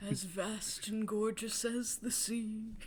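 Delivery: slow, soft recital; dreamy poem. Acted. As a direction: recite tenderly to lovers. Old man reciting poetry.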